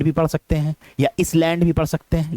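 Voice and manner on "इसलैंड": इसलैंड said "is-land" with short I and S; wrong pronunciation. Shown as a demonstration. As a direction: start इसलैंड with "iss". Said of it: "लेकिन गलत है".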